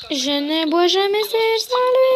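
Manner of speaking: high, stretched, silly singsong; rising singsong. Acted. Redoing the line about the favourite cup, rising singsong.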